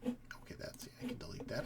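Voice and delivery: quiet off-mic murmur; muttered aside. Real, not acted. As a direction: drop to a private whisper while fixing something. Off-mic aside while fixing something.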